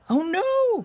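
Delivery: very high alarmed cry. A narrator's reading. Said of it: "cried Morty".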